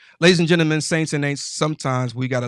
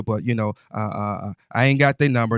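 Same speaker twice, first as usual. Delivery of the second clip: ordinary talk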